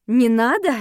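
outraged questioning repeat; indignant repeat. A narrator's reading. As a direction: throw the words back in disbelief. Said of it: "повторила я".

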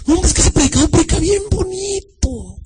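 very high mock-admiring voice; gushing church member. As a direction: mimic a swooning fan of the preacher.

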